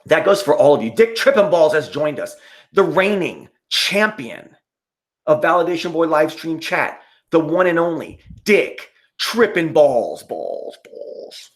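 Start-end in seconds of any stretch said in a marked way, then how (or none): 0.9-10.2 announcer intro. Acted. Streamer announcing a chatter like a ring announcer, grand. 10.2-11.5 softer and softer echo, last one unvoiced; fading echo. Announcer's name echoing off into a whisper.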